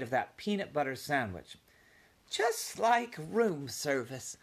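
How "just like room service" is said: slow, high, awed wonder; marveling mother mouse, slow and high. Acted. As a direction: savor the amazement. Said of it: "she marveled".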